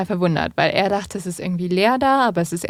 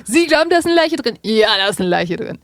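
mock shouting; 3 semitones higher, 4 dB louder than usual. Acting the killer confessing to onlookers, mock shouting. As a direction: call it out as a pretend public confession. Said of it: "wenn er einfach laut geschrien hätte".

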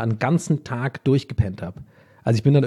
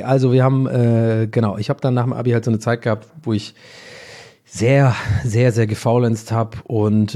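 usual speaking voice

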